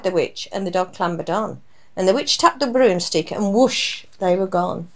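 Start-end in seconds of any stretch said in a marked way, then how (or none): none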